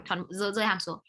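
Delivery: usual speaking voice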